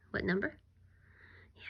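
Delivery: ordinary delivery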